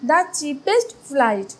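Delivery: the cheapest flight said very slowly; slow emphatic answer. Shown as a demonstration. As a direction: read the correct answer slowly and clearly. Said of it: "Option three will be the right answer".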